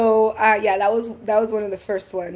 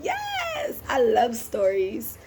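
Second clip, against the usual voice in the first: squealed yes, pitched way up; hammed-up eager yes, very high; 3 semitones higher, 3 dB quieter, slower than usual. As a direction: jump at it like a fan. Driver eager to hear story.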